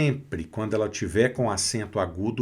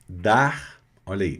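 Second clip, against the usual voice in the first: usual speaking voice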